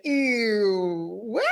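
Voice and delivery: drawn-out mock "ew"; acted horrified cringe, played for laughs; cringing disgust. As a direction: recoil from your own recorded voice. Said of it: "you'll be like".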